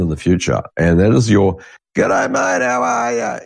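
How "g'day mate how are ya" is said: much higher, hammed-up g'day mate; mock the old Aussie hello; old Aussie greeting. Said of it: "the old Aussies".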